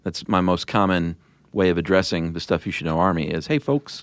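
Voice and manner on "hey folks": friendly, folksy hello; chummy greeting. Retold or imitated. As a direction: greet them like old pals. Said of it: "It just sounds, like, uh, chummy to me".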